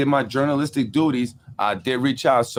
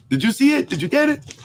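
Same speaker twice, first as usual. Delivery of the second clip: high, mock-eager pestering voice; mocking the sender. Vlogger mimics the emailer's follow-up, high mocking voice. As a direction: parody the pushy emailer, pitched way up.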